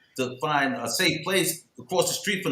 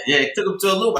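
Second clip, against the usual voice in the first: usual speaking voice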